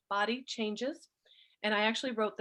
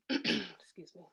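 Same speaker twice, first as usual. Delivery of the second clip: ordinary delivery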